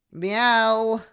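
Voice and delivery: high, belted human meow; loud meow. Pretend. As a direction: yell out a cat's meow. Plays the cat, loudly.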